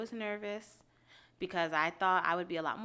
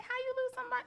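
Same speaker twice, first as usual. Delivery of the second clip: incredulous; faster than usual. Retold or imitated. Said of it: "But I'm like".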